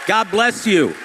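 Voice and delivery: thanking a cheering crowd at full voice; calling out to crowd. Real, not acted. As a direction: project it to the back of the arena.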